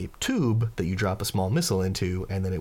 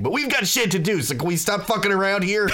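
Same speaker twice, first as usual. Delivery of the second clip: raised pitch, exasperated push; impatient protest; 11 semitones higher, 5 dB louder than usual. Acted. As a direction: break off the fun and hurry them along. Gol, acted, hurries the group along.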